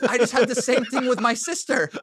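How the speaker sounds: high, loud imitation of an excited wife; excited retold reply. Husband imitates wife sharing her coincidence, excited and high.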